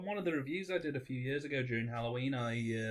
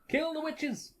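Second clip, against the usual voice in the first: high, hammy witch-hunt cry; mock angry mob; 13 semitones higher, much slower than usual. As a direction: shout it like a torch-waving villager.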